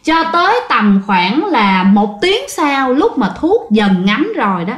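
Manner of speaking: ordinary delivery, nothing marked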